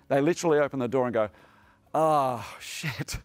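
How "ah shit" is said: muttered, deflated "ah, shit", last word breathed out; dismayed groan. Retold or imitated. Protectors at the door seeing a chairs-only circle, mock dismay. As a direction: let the disappointment drop out under your breath.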